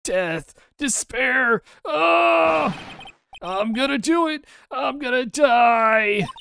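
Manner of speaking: high melodramatic shouting; hammed-up wailing, drawn out at the end; screaming in despair. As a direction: bellow each cry like a doomed man. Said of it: "your client screaming over there".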